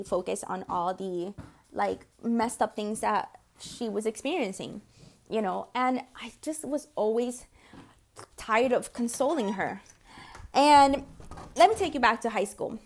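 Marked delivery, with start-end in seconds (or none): none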